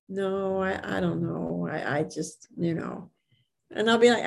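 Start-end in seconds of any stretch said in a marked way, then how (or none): 0.1-3.1 soft, hesitant, shrinking from praise; meek mumble. Retold or imitated. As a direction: brush off the compliment in a small voice. Women leaders deflecting a compliment.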